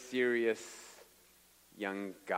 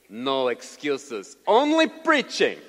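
put-on slow, loud Russian-accented command; Igor; 9 dB louder, much slower than usual. Russian translator to the sick preacher, stern Russian-accented order. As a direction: throw it back in a heavy Russian accent. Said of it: "And he says to me".

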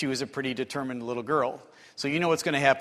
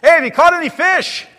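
loud, high call across the water; yelling out; 13 semitones higher, 14 dB louder than usual. Retold or imitated. Stranger on shore yelling to fishermen in the boat, loud high call. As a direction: shout it to someone far out on a boat. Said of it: "he yells out to him".

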